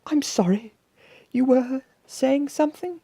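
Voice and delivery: very high, airy polite voice; genteel high-voiced monster mother. A narrator's reading. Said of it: "Then she turned to Basil".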